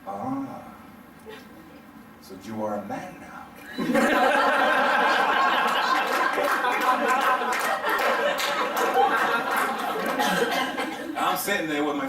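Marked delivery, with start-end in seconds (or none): 0.1-3.5 quiet, careful father to son after crash; gentle, careful. Retold or imitated. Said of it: "very gently, carefully says". 3.7-11.1 big real burst of laughter; laughing hard at the punchline; long laugh. Real, not acted.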